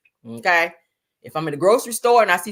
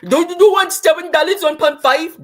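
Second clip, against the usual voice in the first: hammed-up imitation of a gas station clerk; mocking the 7-Eleven cashier in a put-on accent; mock store clerk accent; 9 semitones higher, 9 dB louder than usual. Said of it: "Them fools down there at the seven eleven".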